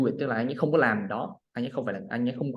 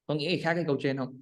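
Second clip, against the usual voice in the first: ordinary talk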